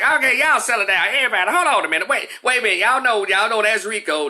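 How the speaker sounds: high, loud-ish nervous teacher; flustered teacher. Retold or imitated. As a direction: imitate a shaky teacher calming a class. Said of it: "My teacher like".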